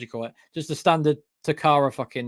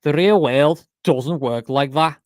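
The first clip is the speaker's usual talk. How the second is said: slow, louder Scouse impression; Scouse accent; 6 dB louder, slower than usual. Shown as a demonstration. Imitating a combat sports YouTuber for James, Scouse accent. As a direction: put on a Scouse accent, drawing words out. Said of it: "normal Scouse".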